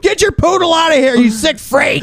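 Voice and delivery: high, hammed-up disgusted shout; mad yelling. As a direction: order them out in mock fury. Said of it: "I'm getting mad and I'm freaking out".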